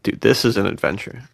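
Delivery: ordinary delivery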